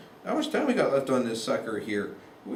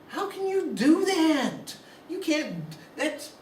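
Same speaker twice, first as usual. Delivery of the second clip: much higher, slow, incredulous; exasperated outburst; 12 semitones higher, slower than usual. Real, not acted. Lecturer to the class about a deceptive graph, exasperated and high. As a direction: throw up your hands at the graph.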